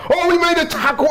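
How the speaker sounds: high-pitched mock celebration; mock over-excited fan. Pundit mocking over-positive fans to his co-hosts, mock over-excited. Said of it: "It's like".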